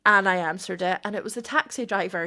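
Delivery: ordinary delivery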